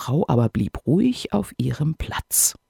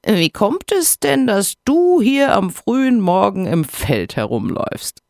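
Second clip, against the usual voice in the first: sneering, much higher hare voice; mocking hare; 7 semitones higher, 3 dB louder than usual. A narrator's reading. Hare mocks the hedgehog's morning walk, sneering. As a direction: scoff at the hedgehog from above. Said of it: "wobei er eine höhnische Miene machte".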